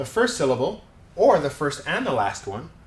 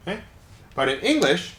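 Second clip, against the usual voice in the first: ordinary talk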